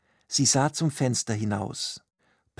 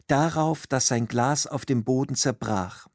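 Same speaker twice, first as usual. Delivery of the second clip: plain reading voice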